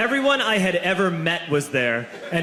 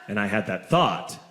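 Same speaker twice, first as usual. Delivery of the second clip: usual speaking voice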